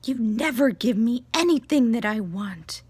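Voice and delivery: acted-out indignant whine; storming-off kid. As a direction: imitate a kid's parting shot. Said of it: "She storms off".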